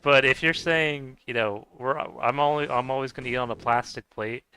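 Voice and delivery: normal talking voice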